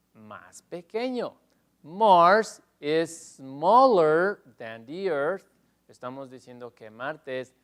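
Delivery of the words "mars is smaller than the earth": very slow, louder English model sentence; teacher reading the slide question slowly. Shown as a demonstration. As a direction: spell it out slowly for the class. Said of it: "repeating model answer to class".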